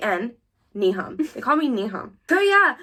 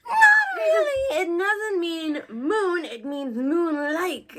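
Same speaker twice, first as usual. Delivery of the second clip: exasperated, spell out moon-like slowly; exasperated correction; 8 semitones higher, much slower than usual. Retold or imitated. Correcting someone who says her name just means moon. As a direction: burst high on Not really, then slow down hard on moon-like. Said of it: "I'm like".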